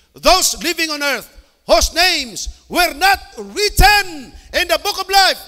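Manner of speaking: slow, loud, high preaching of the verse; preaching scripture. Acted. Preacher reading Revelation to the congregation, slow and loud. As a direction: proclaim each word slowly to the church. Reads scripture at full force.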